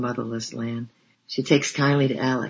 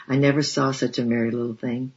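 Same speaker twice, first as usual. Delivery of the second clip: ordinary delivery, nothing marked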